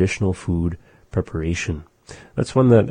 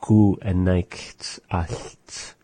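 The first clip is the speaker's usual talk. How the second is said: slow repetition; much slower than usual. Shown as a demonstration. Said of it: "repeats slowly for learner".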